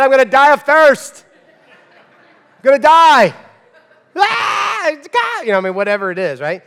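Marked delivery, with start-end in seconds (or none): 0.0-5.5 put-on high, loud wail of a melodramatic kid; mock a child's over-the-top tantrum, very high and loud; whiny thirsty child. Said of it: "Do you know who talks like this? Children".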